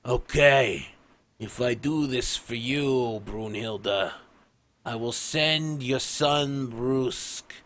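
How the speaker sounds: slow, weighty dwarf patriarch; Orefather. Acted. Orefather granting Brunhilde's plea for her son, slow patriarch voice. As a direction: grant the favor slowly, as head of the family. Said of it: "the Orefather's just like".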